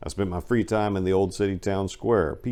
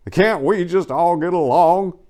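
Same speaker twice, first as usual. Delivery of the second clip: quoting Rodney King; 10 semitones higher, 7 dB louder than usual. Retold or imitated. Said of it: "following Rodney King's epic quote".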